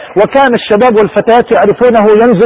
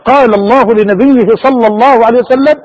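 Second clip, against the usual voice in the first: normal talking voice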